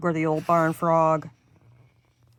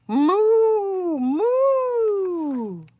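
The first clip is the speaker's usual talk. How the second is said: stretched, much higher moo, louder; high mooing cow; 12 semitones higher, 3 dB louder than usual. Acted. The confused cow moos loud and high. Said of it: "who muttered".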